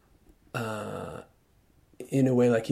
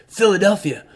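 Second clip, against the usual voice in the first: much higher, scornful jab at a Yankee; mocking southern local; 5 semitones higher, 4 dB louder than usual. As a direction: sneer it like a hostile country man. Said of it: "hostile man on the roadside".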